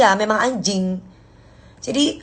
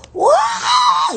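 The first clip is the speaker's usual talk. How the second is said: sudden high yelled wah; angry outburst. Shown as a demonstration. Host demonstrating to viewers how real anger sounds: yelled wah. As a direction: blast out the wah like a furious person. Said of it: "Kalau orang marah itu".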